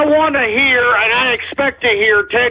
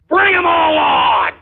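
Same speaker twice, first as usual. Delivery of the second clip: defiant shout; 6 semitones higher, slower than usual. Real, not acted. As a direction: throw the challenge out to every rival. Rally rant at party bosses.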